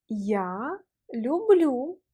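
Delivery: Я люблю very slow, each sound held; slow repeat-after-me. Shown as a demonstration. Teacher asks the student to say it along, very slowly. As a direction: draw out люблю syllable by syllable. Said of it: "Prononce avec moi, s'il te plaît".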